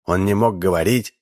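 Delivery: usual speaking voice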